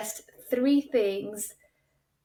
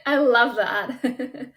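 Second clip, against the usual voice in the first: laughing; 3 semitones higher, 5 dB louder, much slower than usual. Real, not acted. Laughs at the Scottish demo.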